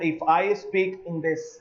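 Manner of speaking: normal talking voice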